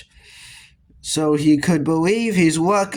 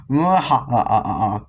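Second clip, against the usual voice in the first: deep villain cackle; evil bellowed laugh; 4 semitones lower than usual. A narrator's reading. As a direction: ham up a low evil laugh. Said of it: "bellowed Dizzy Dave".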